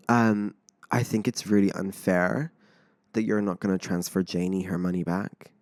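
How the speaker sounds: hushed mock reproach; muted retold complaint. Retold housemate's complaint in the hallway.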